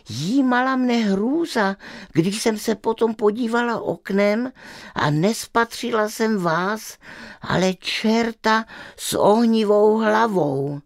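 put-on high, fearful little girl's voice; a scared girl gasping out her tale; frightened girl. Girl describes the fiery-headed devil to the witch, high and frightened.

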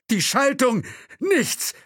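high, loud panicked cry; terrified the brakes are dead. A narrator's reading. Chauffeur cries out in panic that the brakes fail. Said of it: "Panik in der Stimme".

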